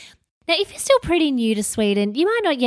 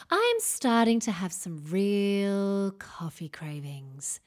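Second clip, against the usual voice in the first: whisper; 8 dB quieter, slower than usual. Real, not acted. Confiding craving for coffee.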